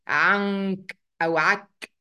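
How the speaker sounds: "عك" with the n swallowed away; wrong dropped noon. Shown as a demonstration. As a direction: say "عنك" losing the n. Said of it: "مش بلغي النون خالص".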